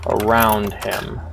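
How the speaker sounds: slow, measured reading of the game's text; slow story reading. A narrator's reading. Streamer reading the game's story aloud, slow and measured. Streamer reads game narration.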